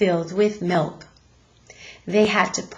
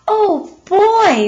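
excited; 12 semitones higher, 8 dB louder than usual. Acted. Boy thrilled by invitation.

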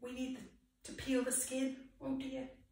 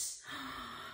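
sharp gasp; 14 semitones lower, 15 dB quieter than usual. Acted. Mock shock at Mama's bite.

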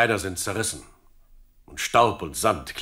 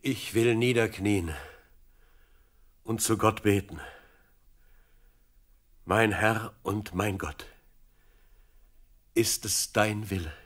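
higher-pitched, measured plea to God; solemn prayer; 4 semitones higher than usual. Acted. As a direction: pray reverently, leaving long pauses between phrases. David kneels and prays to God. (andächtiges, fragendes Gebet)

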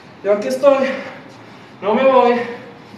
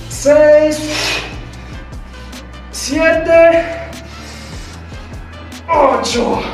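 barking gym coach; 5 semitones higher, much slower than usual. Acted. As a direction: bark out each rep to the class. Coach counting reps in class.

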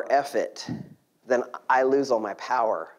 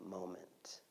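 hushed; 6 semitones lower, 25 dB quieter than usual. Real, not acted. Hushed close of thought.

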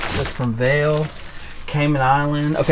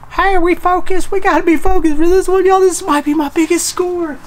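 much higher, louder, giddy excitement; thrilled; 6 dB louder than usual. Real, not acted. Vlogger finds old silver coins, high giddy excitement. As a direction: bubble over like you hit the jackpot.

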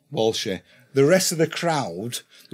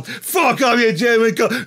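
taunting shout; 7 semitones higher, slower than usual. Retold or imitated. Taunting downed opponent.

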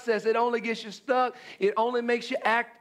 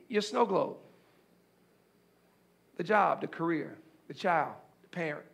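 gentle hushed pastoral voice; hushed intimate preaching; 5 semitones lower than usual. Acted. Preacher drops to intimate hush.

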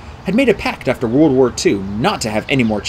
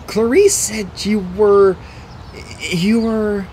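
higher, halting and drawn-out; hesitant; 8 semitones higher, much slower than usual. A narrator's reading. Boy asks his mentor about bad news, hesitant. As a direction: trail off, reluctant to finish the sentence.

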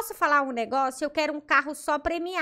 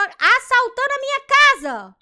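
shocked outcry; 8 semitones higher, 10 dB louder than usual. Real, not acted. Catching a burglar in her house.

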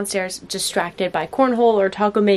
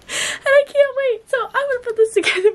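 excited; 14 semitones higher, 4 dB louder than usual. Real, not acted. Sister gushing over the engagement.

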